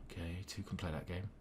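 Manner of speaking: said fast and very softly; quiet muttering. Real, not acted. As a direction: mutter it quickly to yourself. First take, muttered to himself.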